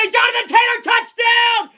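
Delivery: high, shouted touchdown call at full voice. Real, not acted. Fan calling the winning touchdown for viewers, high and shouting. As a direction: scream it like the game-winning score.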